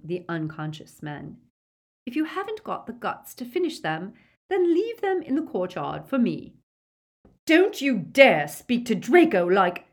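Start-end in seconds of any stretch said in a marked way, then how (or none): 2.1-6.5 high-pitched, taunting contempt; scornful order. A narrator's reading. Aunt taunts Draco over finishing the men, high scornful order. 7.5-9.9 loud, higher, slow indignant rebuke; furious. A narrator's reading. Mother defends Draco to her sister, furious loud rebuke. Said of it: "said Narcissa furiously".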